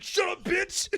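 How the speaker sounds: high, loud squeaky shut-down; play-yell it in a high voice; hammed-up angry outburst; mock angry retort. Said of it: "You'd be like".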